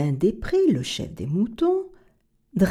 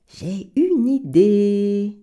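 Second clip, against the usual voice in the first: beaver voice; much slower than usual. Acted. Beaver speaks.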